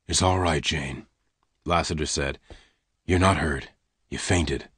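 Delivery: calm her quietly; low soft gunman. Acted. Lassiter soothes Jane.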